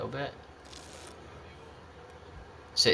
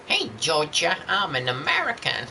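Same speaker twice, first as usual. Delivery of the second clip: a light put-on American accent, pitched a bit high; hammy American voice greeting Georgia; mock American accent; 6 semitones higher than usual. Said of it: "What kind of accent's that".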